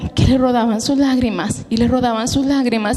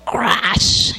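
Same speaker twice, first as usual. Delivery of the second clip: crash sound; 2 semitones lower than usual. Retold or imitated. Lamp shatters.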